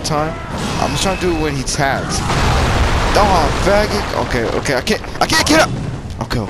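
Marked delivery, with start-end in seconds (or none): 3.2-4.3 drawn-out loud angry curse; slow furious curse. Real, not acted. Hit by the boss. 4.7-5.7 high, loud panicked complaint; panicked frustration. Real, not acted. Stuck on the ground in fight.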